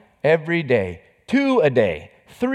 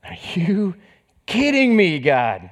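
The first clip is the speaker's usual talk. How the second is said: incredulous outburst; 6 semitones higher, slower than usual. Retold or imitated. Father crying out at God in disbelief, building. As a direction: whisper rising to a shout.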